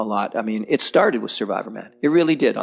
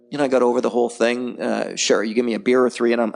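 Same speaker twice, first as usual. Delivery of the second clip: ordinary delivery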